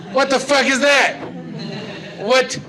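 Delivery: mock outrage at an absurd sight; incredulous outburst. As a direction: react baffled, voice jumping up. Comic disbelief at treadmill reader.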